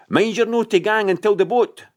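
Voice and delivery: put-on high Scots call after a boy. A narrator's reading. Ayrshire mother calling after her son in Scots. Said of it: "she cried after him".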